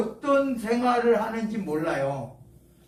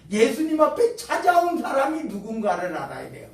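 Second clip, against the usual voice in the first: ordinary talk, nothing marked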